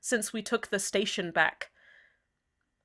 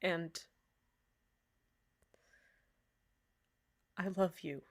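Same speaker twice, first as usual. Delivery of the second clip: tender confession; 2 semitones lower, 11 dB quieter than usual. Acted. Confession after long pause.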